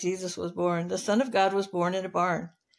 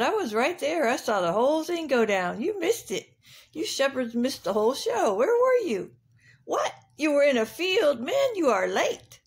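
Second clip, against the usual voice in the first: higher-pitched rooster, swooping up on key words; cocky rooster; 5 semitones higher than usual. Acted. Rooster scolding the shepherds who missed the whole show, cocky. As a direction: crow at them like an indignant rooster. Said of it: "Rooster said".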